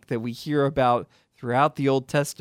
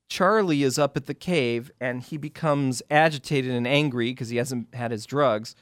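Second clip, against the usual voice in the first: ordinary delivery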